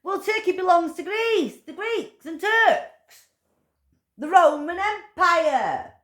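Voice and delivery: high, loud furious ranting; shouting down the king in rising fury; angry rant. Real, not acted. Ranting to the camera about King Charles, high and loud with fury. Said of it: "I've had my little rant".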